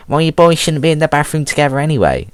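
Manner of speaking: high, mocking imitation of a nagging classmate; mocking high woman voice. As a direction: whine it like a know-it-all girl. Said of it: "She's like".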